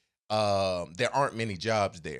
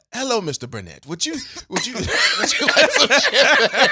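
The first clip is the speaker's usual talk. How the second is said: imitated African accent breaking into high, loud laughter; retry the fake African accent and crack up midway; 13 semitones higher, 9 dB louder than usual. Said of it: "Ac-, African accent?".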